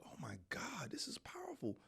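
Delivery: whispered amazement; hushed, breath-only exclamation; awed whisper. Retold or imitated. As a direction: whisper it as an awestruck aside. Retold awed reaction to the scene.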